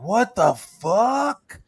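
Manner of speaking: high, slow, drawn-out disbelief; stunned kid. Retold or imitated. A boy seeing the Predator's face on TV, stunned and high. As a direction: stretch it out, jaw on the floor.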